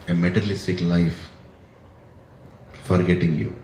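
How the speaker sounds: a devotee's hushed admission of fear; humble confession. Acted. Prahlad confessing his fear.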